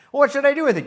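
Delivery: high loud pleading; hammed-up desperate kid asking for help; whiny Charlie Brown. Charlie Brown begging Lucy the psychiatrist, high loud whine.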